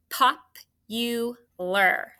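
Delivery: popular drawn out very slowly, syllable by syllable; slow dictation. Shown as a demonstration. Teacher dictating popular to the class, very slow and stretched. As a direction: stretch popular out so every sound is clear. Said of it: "your word is".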